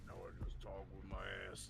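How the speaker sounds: very deep voice. Acted. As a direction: sink it way down low. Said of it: "just talk it all low".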